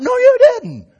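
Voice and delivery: very high, loud shriek of disbelief; hyper lady. Retold or imitated. Hyper lady from the fellowship disputing the casserole, shrieked disbelief. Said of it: "kind of a hyper lady".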